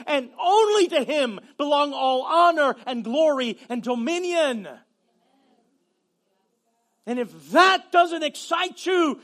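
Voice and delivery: loud, rising preacher's shout; preaching at full force. Acted. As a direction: build to a fervent shout.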